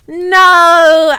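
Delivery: drawn-out loud wail; hammed-up cry of protest; mock scream. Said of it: "I screamed sexily".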